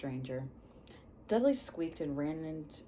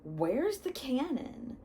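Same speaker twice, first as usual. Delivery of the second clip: dopey question; 8 semitones higher, 3 dB louder, slower than usual. Acted. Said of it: "he said stupidly".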